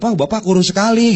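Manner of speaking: mock-sweet praise of a ruined haircut; fake flattery. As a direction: overdo the praise until it stings. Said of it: "orangnya gendut dibilang".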